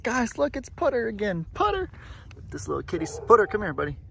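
high, bright call to the cat; squeaky kitty-calling voice; excited cat call. Real, not acted. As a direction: sing out the cat's name in delight. Spots the neighborhood cat.

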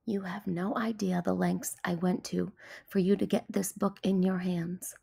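ordinary delivery, nothing marked

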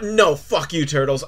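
usual speaking voice

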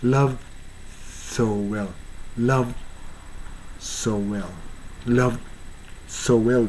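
"so well" with the w sliding into "so"; soft linked "so well". Shown as a demonstration. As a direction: let "so well" blur into "sowell". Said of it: "言おうかな". (「so well」をつなげて発音)

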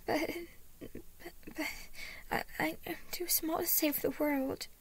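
put-on high, stammering little-boy voice; starting to panic. A narrator's reading. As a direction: stumble over the words like a worried child. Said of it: "he began to panic".

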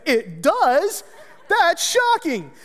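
high, slow, hammed-up surprise; mock shock. Mock surprise at nurse's scale.

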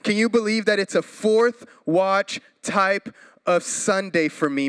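ordinary delivery

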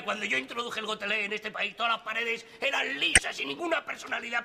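ordinary talk

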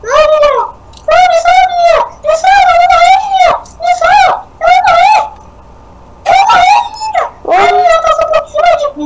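very high squealed plea for help; panicked pleading. Real, not acted. Player begging a teammate to save them, panicked pleading. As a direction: squeal desperately for rescue.

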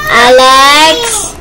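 normal talking voice

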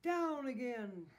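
loud, drawn-out call; high, booming command. A narrator's reading. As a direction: call the order down to the team. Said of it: "called Santa".

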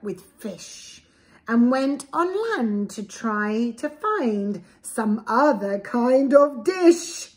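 lively, sing-song storybook read; reading to children. A narrator's reading. Parent reading a picture book to a child, lively storybook voice. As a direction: read it aloud to little ones with relish.

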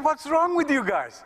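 high, incredulous protest; indignant bird woman. Retold or imitated. Bird woman answering the scientists, high and indignant. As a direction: defend her kind, baffled.

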